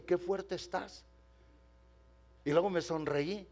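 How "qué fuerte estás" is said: put-on soft, slow playful praise to a child; teasing warmly. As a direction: gently tease a strong little boy. Pastor teases child gently. (con ternura burlona, despacio y suave)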